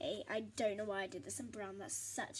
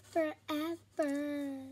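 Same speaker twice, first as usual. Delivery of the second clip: stretched-out forever; 3 semitones higher, 5 dB louder, much slower than usual. Real, not acted. Moaning about the long job.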